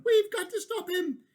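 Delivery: high, loud and urgent; high urgent plea; urgent creature voice. Acted. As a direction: push the others to act. Creatures continue.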